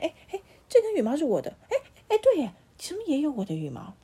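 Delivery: high, loud, fast startled squawks; birds discovering feathers. A narrator's reading. Birds finding their feathers on the crow, loud startled cries. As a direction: cry out in surprise at spotting their own feather. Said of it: "他们开始发现".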